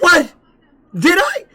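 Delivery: high, startled double take; shocked disbelief. Retold or imitated. To his cornerman, learning he got knocked out: high shocked disbelief. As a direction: sound stunned to hear you were knocked out. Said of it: "I was like".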